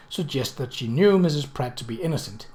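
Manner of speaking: usual speaking voice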